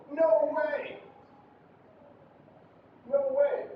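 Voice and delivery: "No way!" high from start to finish; high intonation. Shown as a demonstration. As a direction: keep both words of "No way!" high. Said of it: "It's just high".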